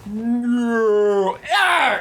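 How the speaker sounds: drawn-out high 'no', then a yelp; mock dread before the blow; victim's cry. Person about to be kicked, mock terrified cry.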